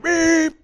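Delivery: high, loud honk imitated by voice; a squeaky horn blast, done with the voice; mock car horn. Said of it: "the person behind me goes".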